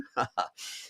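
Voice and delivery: short laugh. Real, not acted. Laugh after homeschooling joke.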